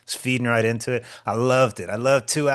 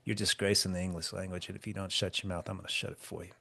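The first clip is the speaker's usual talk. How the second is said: low menacing quote; 3 semitones lower, 13 dB quieter than usual. Retold or imitated. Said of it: "was like".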